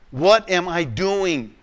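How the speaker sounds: ordinary delivery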